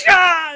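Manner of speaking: loud high wail of grief; anguished wail. Real, not acted. Fan overwhelmed by the sad scene, loud high wail. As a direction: let out a loud high cry.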